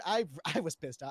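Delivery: usual speaking voice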